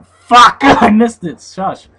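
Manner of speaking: very high, loud cry then laughing; frustrated yell. Real, not acted. Gamer flubs a word and yells at himself.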